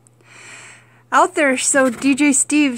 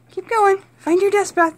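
encouraging pet voice; 8 semitones higher, 4 dB louder than usual. Real, not acted. Cheering chinchilla on.

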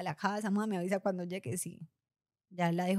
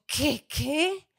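higher, louder double what; shocked disbelief; 6 semitones higher, 4 dB louder than usual. Retold or imitated. Daughter hearing her mother needs surgery, shocked. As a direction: hit the news like a slap.

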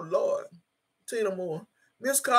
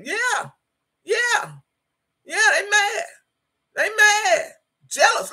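fired-up preaching; 11 semitones higher, slower than usual. Real, not acted. Preaching at commenters' jealous critics.

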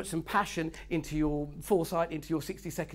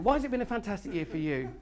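usual speaking voice

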